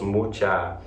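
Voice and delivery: ordinary delivery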